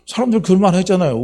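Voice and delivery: normal talking voice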